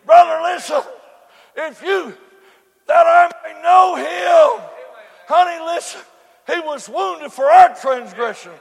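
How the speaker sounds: loud, rising preacher cadence, hitting key words; preaching at full force. Acted. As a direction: whoop it up to the congregation. Preaching returns after the story.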